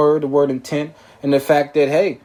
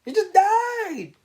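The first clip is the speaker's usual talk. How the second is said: much higher, very slow "He just died"; hammed-up mock attorney; much slower than usual. Host mocking the defense attorney's excuse, drawn out and high. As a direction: stretch it out, sing-song innocent. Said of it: "he's trying to say".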